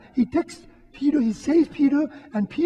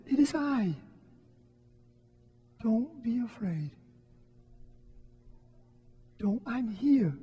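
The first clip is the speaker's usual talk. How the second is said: gentle reassurance; 7 dB quieter than usual. Retold or imitated. Jesus voiced calming the disciples.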